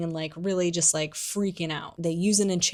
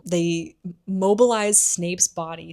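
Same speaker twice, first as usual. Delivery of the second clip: normal talking voice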